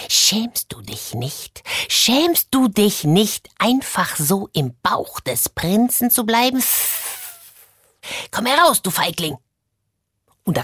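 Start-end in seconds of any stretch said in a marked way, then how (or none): 0.1-1.6 low slow hissing reproach; a snake's slow accusing hiss. A narrator's reading. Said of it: "hörte sie die Schlange zischen". 1.9-9.4 the snake builds to an angry cry. A narrator's reading. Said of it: "hörte sie die Schlange zischen".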